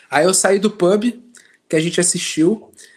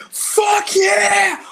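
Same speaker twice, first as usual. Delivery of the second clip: very high whooping yell; mock celebration shout; much slower than usual. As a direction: cheer like a hyped fan.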